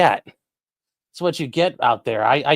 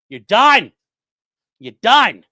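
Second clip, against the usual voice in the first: loud hype; 5 semitones higher, 9 dB louder, slower than usual. Real, not acted. Hyping the donor.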